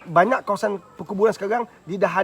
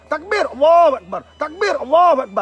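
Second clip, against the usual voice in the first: high, loud call and response; shouted takbir; 9 semitones higher, 9 dB louder than usual. Shown as a demonstration. Preacher showing what to shout at home, shouted takbir. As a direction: shout it out as a rallying chant. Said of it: "Dalam rumah kan kita jerit".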